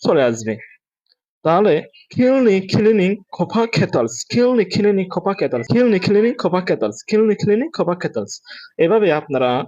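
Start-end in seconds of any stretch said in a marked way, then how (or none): 2.1-8.4 higher, run-together tongue twister; quick practice. Shown as a demonstration. Teacher shows students how to build speed, quickly. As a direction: rattle it off in repeated rounds. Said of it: "তারপরে দ্রুত বলার চেষ্টা করবেন".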